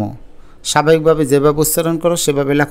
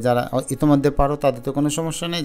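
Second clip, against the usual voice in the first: ordinary delivery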